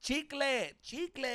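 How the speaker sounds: high-pitched mock yell of a drunk woman; drunk girl yelling. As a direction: shriek the word like a drunk heckler. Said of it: "yelled it again".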